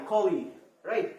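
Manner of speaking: ordinary talk, nothing marked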